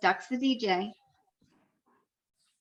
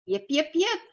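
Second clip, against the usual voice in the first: fox calling; 4 semitones higher than usual. A narrator's reading. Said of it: "She called".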